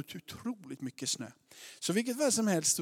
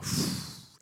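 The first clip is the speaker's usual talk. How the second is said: long, airy puff of relief; relieved exhale. Retold or imitated. Parents settled on the plane, relieved sigh. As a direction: blow out air as tension lifts.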